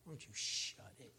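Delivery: breathy half-voiced brush-off; a mock-grumpy aside to his wife; retold irritation, unvoiced and dismissive; snappy retort. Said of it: "And I was like".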